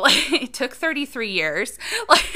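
laughing while she talks; real laughter breaking in. Real, not acted. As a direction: laugh through the words. Laughs at own late insight.

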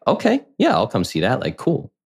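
high, upbeat, carefree okay; casual chirpy agreement. Retold or imitated. Agreeing to his mom and sister's movie plan, high and breezy. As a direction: toss it off lightly, sky-high on the yeah.

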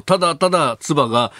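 ordinary talk

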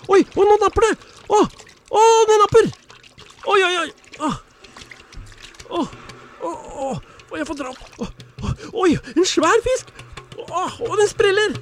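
high, loud excited yelps; excited fishing. Acted. Fisherman hauling in a big fish, high excited yelps. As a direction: get thrilled as the fish bites.

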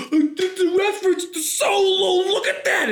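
gasp, then very high mock squeal; overexcited fan. Fan spotting Solo card game, mock overexcited squeal. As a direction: gasp and gush, high and stretched out. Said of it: "they would make this huge deal about this".

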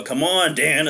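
light mock evil taunt; a hint of a scheming villain; mustache twirling villain. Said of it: "as I'm twirling".

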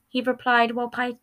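ordinary delivery

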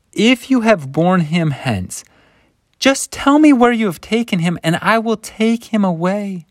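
a frantic woman begging the gardener; desperate pleading. Retold or imitated. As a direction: beg through tears, voice breaking high. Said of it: "so much frazzlement and panic and pain".

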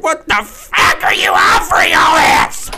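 John Taffer having a meltdown, high and shouted; mock apoplectic rant. Podcaster imagining the bar expert walking into the restaurant, mock apoplectic rant. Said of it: "have a fucking stroke, dude".

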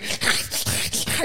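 imitated frantic dog, voiceless rasping barks; raspy snarling dog imitation; crazy dog noises. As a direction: mimic a dog going crazy. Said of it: "making those sounds and everything".

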